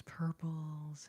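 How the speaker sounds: usual speaking voice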